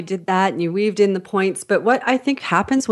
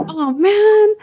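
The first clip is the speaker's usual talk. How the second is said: high, drawn-out groan of dismay; mock dismay; 13 semitones higher, 4 dB louder than usual. Driver pulled over by the police, mock dismay. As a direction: lament it like a caught driver.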